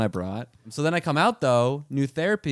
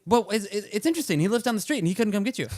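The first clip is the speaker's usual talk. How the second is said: higher, quick sarcastic mom voice; pitched-up mock mom; imitating mom; 9 semitones higher, faster than usual. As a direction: needle him like a sceptical mother. Voicing his mom again.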